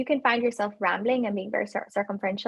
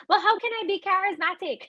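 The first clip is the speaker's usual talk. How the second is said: mock candidate question; 8 semitones higher than usual. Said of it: "a common question".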